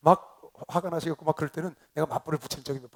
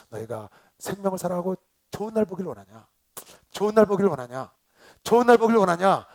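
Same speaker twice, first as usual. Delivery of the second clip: preaching question, building to loud; building exhortation; 3 semitones higher, 7 dB louder than usual. Real, not acted. Pastor quotes apostle Peter to congregation, building to loud exhortation. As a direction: repeat the question with growing volume.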